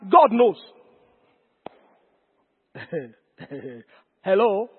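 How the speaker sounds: ordinary talk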